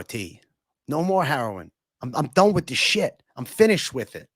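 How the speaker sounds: pleading, strung-out mobster talking to his boss; lightly done Chrissy whine; Christopher from the Sopranos. Retold or imitated. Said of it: "Chrissy from Sopranos".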